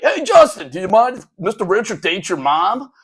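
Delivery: put-on high, loud kid's holler; calling out to classmate. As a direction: imitate a student hollering to a friend. Kid calls to classmate across room.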